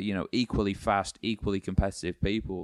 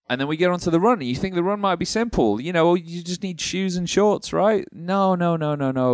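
mock-innocent, pitched higher, then a teasing no, no, no; sarcastic; 10 semitones higher, 5 dB louder than usual. Host mocking gear-obsessed triathletes, sarcastic and higher. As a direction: play it up as if the run were simple, then knock it down. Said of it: "you were being sarcastic with all that".

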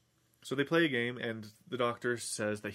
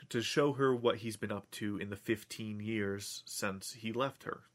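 ordinary talk

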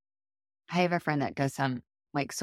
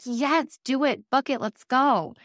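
high, loud retold burst of enthusiasm; excited urging; 9 semitones higher, 5 dB louder, slower than usual. Egging a friend on to book the trip, excited. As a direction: cheer them on to commit. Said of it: "I was so excited".

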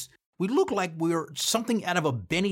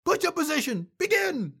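mock drill-commander yell, pitched high; retold drill orders; 12 semitones higher than usual. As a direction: bark push-up orders, imitating the commander.

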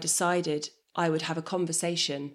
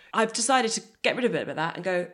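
ordinary talk, nothing marked

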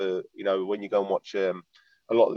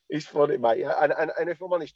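ordinary delivery

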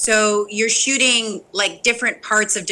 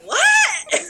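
surprised what. Retold or imitated. Daughter reacting to her dad's shout, high shocked laugh. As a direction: yelp it back in disbelief.

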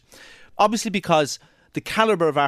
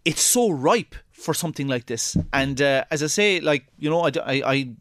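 ordinary talk, nothing marked

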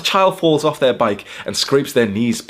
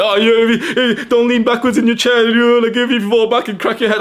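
much higher, whiny put-on teacher voice; sneering imitation of a fussy schoolteacher; mocking teacher; 12 semitones higher, 5 dB louder than usual. Student mocking teacher's warning about leaning back, much higher whine. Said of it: "the fucking dumbass teacher was".

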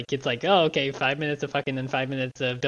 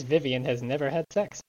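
usual speaking voice

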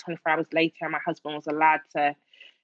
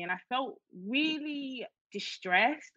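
real emotion breaking through, higher, slow and softer; emotional; 6 semitones higher, 5 dB quieter, much slower than usual. Real, not acted. Telling the interviewer about her baby's birth, emotional. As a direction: let the feeling catch you as you say it. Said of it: "I get so emotional about it".

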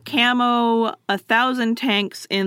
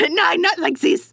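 high, louder "Nine, not like this"; hammed-up German dismay; mock German accent; 8 semitones higher, 4 dB louder than usual. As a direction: despair in a hammy German accent. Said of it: "That's more French".

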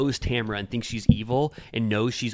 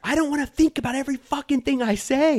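high, louder exasperated outburst; exasperated Heather; 14 semitones higher, 4 dB louder than usual. Retold or imitated. Host mimics Heather snapping at the women, high and fed up. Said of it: "Heather goes".

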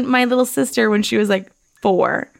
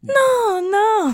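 high-pitched mock denial; protesting mum; 12 semitones higher than usual. Mum denying to her daughter that she's hungry, high protest. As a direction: wave it away in mum's high voice. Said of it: "Mom, you're hungry".

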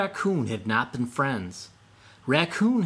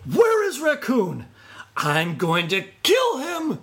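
higher, louder enraged yell; furious shout; 11 semitones higher, 4 dB louder than usual. A narrator's reading. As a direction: storm in bellowing for Raccoon. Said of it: "Fox came storming through the forest".

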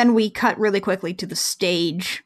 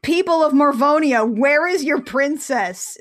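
higher, louder mock proclamation; grand stage announcer; 5 semitones higher, 3 dB louder than usual. Host mimicking Jem opening the concert, grand proclamation. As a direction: declaim it to a crowd, drawing out the end.

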